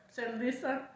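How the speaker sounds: usual speaking voice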